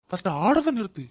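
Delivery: loud barked order; angry shout. Acted. Husband shouting at wife to stop, angry shout.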